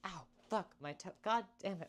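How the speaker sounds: pained yelp from a stubbed toe; stubbed toe, swearing in pain. Real, not acted. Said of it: "I stubbed my toe".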